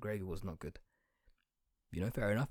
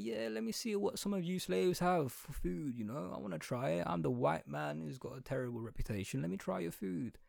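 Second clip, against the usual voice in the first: higher-pitched, put-on curious colonizer; hypothetical colonizer; 6 semitones higher than usual. A colonizer asking the conquered for their food, hammed-up high voice. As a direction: play the eager conqueror asking to taste. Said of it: "I am a colonizer".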